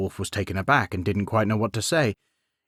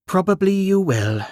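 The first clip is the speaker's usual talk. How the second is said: queen teasing; 9 semitones higher than usual. Acted. Queen echoes Beowulf, teasing.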